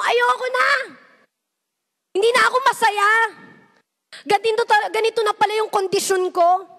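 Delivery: desperate outcry. Pretend. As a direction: let it burst out, worn down and done. Crying out to God.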